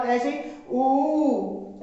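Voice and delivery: ऊ stretched very slowly over two beats; drawn-out long vowel. Shown as a demonstration. Teacher showing a long vowel, ऊ drawn out. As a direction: hold ऊ long and slow. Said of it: "अधिक समय लगा".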